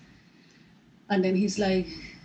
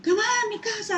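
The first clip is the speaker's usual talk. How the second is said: stranger's call; 4 dB louder than usual. Retold or imitated. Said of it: "He's like".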